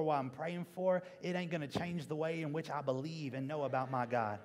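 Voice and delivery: usual speaking voice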